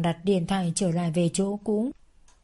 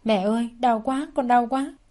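higher, rising cry of pain on đau; 4 semitones higher than usual. Acted. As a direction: cry out "đau quá" as the burn hurts. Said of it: "Tôi đau quá nên gào lên".

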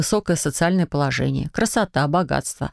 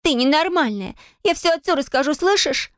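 high, frightened, shrill-pitched threat; panicked threat; 11 semitones higher than usual. Acted. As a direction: threaten while terrified. Terrified sister threatens heroine.

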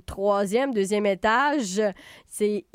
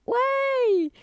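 excited cheer; 14 semitones higher than usual. Real, not acted. Host cheering about festival.

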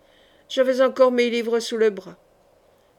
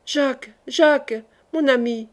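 cry out; 3 semitones higher than usual. A narrator's reading. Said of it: "je me mis à crier bien fort".

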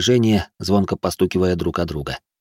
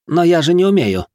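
high, louder objection; dismayed protest; 10 semitones higher, 5 dB louder than usual. A narrator's reading. Loser-to-be objects to a week of cooking, dismayed. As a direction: blurt a protest at the stakes.

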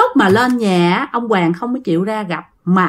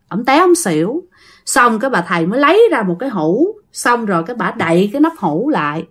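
usual speaking voice

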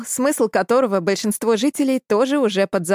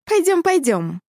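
higher, insistent cat-woman coaxing; impatient; 8 semitones higher than usual. A narrator's reading. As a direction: urge him, impatient and playful. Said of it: "нетерпеливо притопнула ножкой".

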